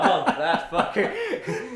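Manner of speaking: slow, laughing through it. Real, not acted. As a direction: drag it out while laughing. Repeats it laughing.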